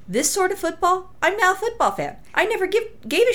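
giddy high-pitched enthusiasm; excited gushing. Real, not acted. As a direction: squeal it like a new fan. Gushing over lingerie football.

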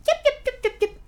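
high mocking yip yip; yappy teen girls. Mocking the chattering teen girls on the bus, squeaky high yips. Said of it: "these little girls are just yip-yapping".

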